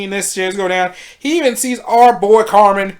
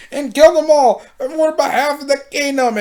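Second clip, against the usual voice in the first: higher, frantic zealot rant; hammed-up bloodthirsty ruler urging slaughter; mock fanatical queen; 6 semitones higher than usual. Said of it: "And then it's like".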